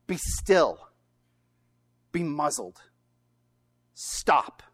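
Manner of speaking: short authoritative orders, each standing alone; stern command. Retold or imitated. Jesus speaking to the waves, stern and authoritative. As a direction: order it firmly, pausing after each command. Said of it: "he's very stern in what he says".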